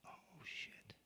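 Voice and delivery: breathless, barely audible whisper; stunned whisper. Real, not acted. Realizing he repeated his stepmom's abuse, whispered in shock. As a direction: let it slip out under your breath.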